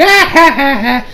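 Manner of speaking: high, mock villain cackle; maniacal laugh. Boo rolls over laughing at his attackers, mock cackle. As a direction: imitate a crazed laugh. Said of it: "starts laughing maniacally".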